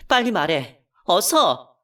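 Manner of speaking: 빨리 말하라고 다그치듯 크게; urging angrily. Retold or imitated. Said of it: "하고 재촉하자". (남편에게 버럭 재촉하는 아내)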